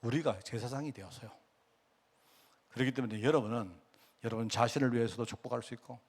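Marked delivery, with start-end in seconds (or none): none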